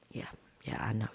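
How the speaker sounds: unvoiced embarrassed whisper; hushed whisper. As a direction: hush it as if embarrassed.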